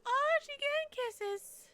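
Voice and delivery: very high, loud, slow gushing squeal. Real, not acted. Streamer squealing with delight as her cat gives kisses.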